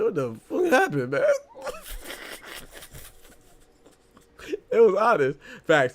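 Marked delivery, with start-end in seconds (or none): 0.0-1.2 high quote breaking into laughter; quoting the fighter while laughing. Retold or imitated. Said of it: "He said". 1.3-3.0 breathless high wheeze; real airless laugh; wheezing laugh. Real, not acted. Laughing at the quote.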